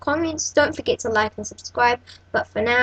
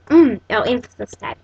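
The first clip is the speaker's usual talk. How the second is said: normal talking voice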